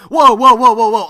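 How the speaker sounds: loud, high jolt awake; startled shout. Shown as a demonstration. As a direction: snap out of the drone with a yell. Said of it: "did, did you fall asleep?".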